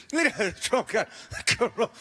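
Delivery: words breaking into laughter; laughing. Real, not acted. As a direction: giggle through the line. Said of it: "I started laughing".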